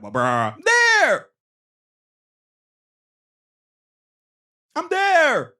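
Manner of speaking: high, loud shout of I'm there; Lowry insisting. Retold or imitated. Lowry yelling to the ref that he's there, high loud shout. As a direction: yell it like a player claiming position. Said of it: "you can see Kyle Lowry like".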